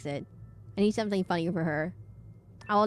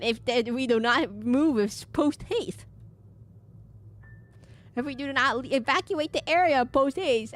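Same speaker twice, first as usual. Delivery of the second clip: high, slow, hammed-up servant voice; mock-formal, drawn-out high voice; Moloch; 5 semitones higher, slower than usual. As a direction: imitate a stuffy minion warning the master. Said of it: "I like how Moloch is like".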